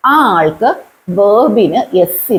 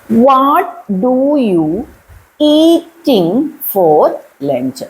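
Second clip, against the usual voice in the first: very slow, drawn-out words; slow dictation; 3 semitones higher, much slower than usual. Shown as a demonstration. Teacher testing learners with a faulty sentence, slow dictation. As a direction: stretch each word out for learners.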